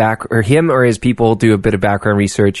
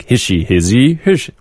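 mocking singsong; 3 semitones higher than usual. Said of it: "they make fun of it".

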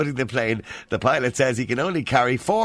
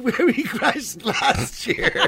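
slow, high, helpless giggling through the words; laughing; 11 semitones higher, slower than usual. Real, not acted. Joke teller cracking up at the punchline, laughing. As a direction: struggle to get the punchline out.

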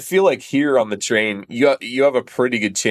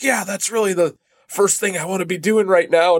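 high-pitched mock irritated retort; aggressive sarcasm; 9 semitones higher than usual. Steroid-dosed bandmate refusing to help, mock aggressive. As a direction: imitate someone snapping back sarcastically, pitch jumping up. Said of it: "he got all, like, aggressive".

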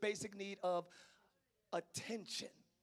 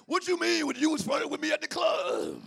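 mock outraged shout; 4 semitones higher, 7 dB louder than usual. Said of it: "they'll look at you like".

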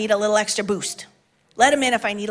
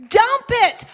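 higher, louder full shout of dump it; shouted command; 12 semitones higher, 5 dB louder than usual. Acted. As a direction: yell it out to the whole church. Repeated as a shout.

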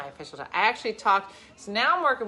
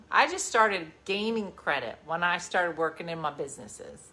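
normal talking voice

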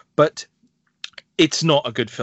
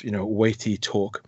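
normal talking voice

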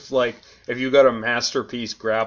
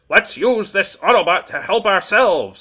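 higher, louder insect villain voice; scheming Transformer insect voice; Kickback voice; 8 semitones higher, 7 dB louder than usual. Acted. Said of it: "I kinda remember what he sounds like".

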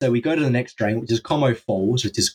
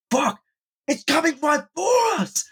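very high mock panic cry; freaked-out yell; 13 semitones higher than usual. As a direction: imitate a friend yelling in alarm. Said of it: "Like that".

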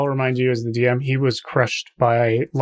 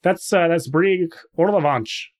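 slow, higher Canadian character voice; Canadian hoser, drawn out and higher; Canadian trapper; 5 semitones higher, slower than usual. Acted. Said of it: "and he goes".

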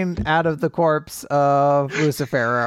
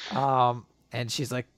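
ordinary talk

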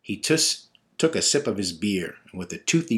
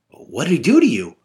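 alarmed; 7 semitones higher, 10 dB louder than usual. Acted. Son alarmed about patrol.

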